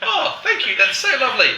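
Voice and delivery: very high, gushing mock thanks; flattered delight. Flattered by the winemaker's Parisian compliment, gushing. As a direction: squeal it like you're chuffed.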